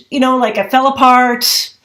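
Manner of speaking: normal talking voice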